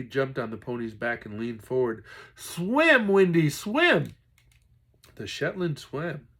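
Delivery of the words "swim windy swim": high, loud, slow pleading call; boy begging his pony to swim; urgent urging. Acted. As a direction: drag out the plea to keep swimming.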